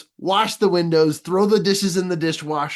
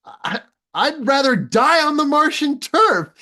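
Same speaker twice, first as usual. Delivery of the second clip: high, put-on huffy voice, louder; mock proud refusal; 12 semitones higher, 5 dB louder than usual. Commander refusing Rocket Man's silly request, hammed-up disdain. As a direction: sniff it out like a stubborn officer.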